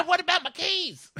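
very high hammed-up screech; exaggerated shrieked interruption; mock outraged demand. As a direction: squeal the question way up high, imitating a furious ex. Imitates the yelling girl.

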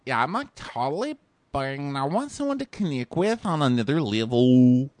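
high mock nasal voice; exaggerated nasal imitation; nasally. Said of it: "they're having a really nasally conversation".

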